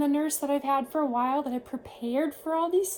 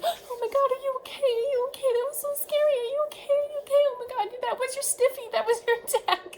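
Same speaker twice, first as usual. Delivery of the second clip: much higher, frantic and breathless; panicked nurse; 11 semitones higher, faster than usual. Retold or imitated. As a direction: gush in high-pitched panic. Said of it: "The nurse is like".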